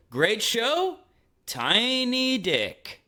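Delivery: high, cheery put-down, slow sting at the end; mean inner voice. Retold or imitated. Inner critic taunting him after a great show, mock cheery. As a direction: sneer it like a smug inner critic. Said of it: "I hear my inner voice go".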